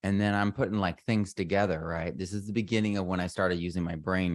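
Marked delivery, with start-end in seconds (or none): none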